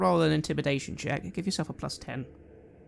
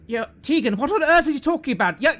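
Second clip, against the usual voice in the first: high, loud, fast outraged woman; a woman shrieking in outrage at the intruder; shrill woman's voice; 14 semitones higher, 8 dB louder than usual. Acted. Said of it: "in a woman's voice".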